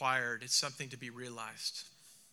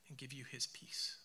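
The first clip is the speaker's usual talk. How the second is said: whispered blessing; 2 semitones lower, 11 dB quieter than usual. Real, not acted. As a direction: whisper the last words of the blessing. Closing blessing.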